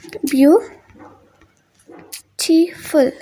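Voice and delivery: ब्यूटीफुल very slowly, long gaps between byu, ti, ful; syllable by syllable. Shown as a demonstration. Teacher marking syllables on the board, syllable by syllable. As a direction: pull ब्यूटीफुल apart into three separate syllables. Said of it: "तीन syllable बन रहे हैं".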